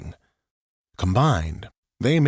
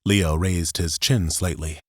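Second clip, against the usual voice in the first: ordinary delivery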